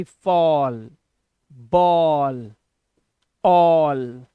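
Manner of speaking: "फॉल", "बॉल", "ऑल" each drawn out on AW; slow, falling model words "फॉल", "बॉल", "ऑल"; double-L reading demo. Shown as a demonstration. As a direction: stretch the vowel slowly in "बॉल" and "ऑल", single L. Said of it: "इसे पढ़ते हैं वॉल".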